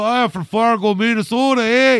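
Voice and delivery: loud, high, hammed-up Minnesota accent; Fargo accent. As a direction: belt it out like a cartoon Minnesotan. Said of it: "more Fargo".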